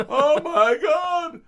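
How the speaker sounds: very high, loud, slow cry of dismay; embarrassed horror. Retold or imitated. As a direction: groan at your old tape. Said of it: "and I gone".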